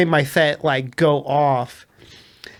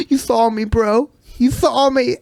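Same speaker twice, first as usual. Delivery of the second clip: higher-pitched, imitated hurt plea; mock near-tears; 7 semitones higher than usual. Mud-covered guy pleads with the driver, mock tearful. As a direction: imitate a man almost crying. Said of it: "like, almost in tears, so sad".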